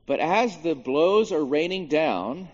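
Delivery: normal talking voice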